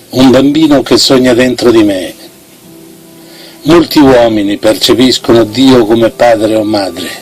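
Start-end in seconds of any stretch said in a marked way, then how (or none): none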